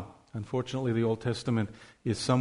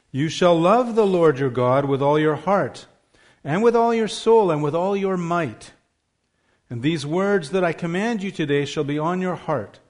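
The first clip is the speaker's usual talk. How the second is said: scripture reading; 3 semitones higher, 4 dB louder than usual. Acted. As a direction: declare each line to the congregation. Reads the Shema passage aloud.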